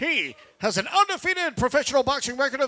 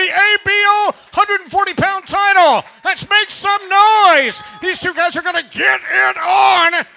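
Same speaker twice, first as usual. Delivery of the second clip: big ring announcer shout; hype shout; 8 semitones higher, 11 dB louder than usual. Real, not acted. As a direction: pump up the crowd, loud. Ring announcer hyping crowd.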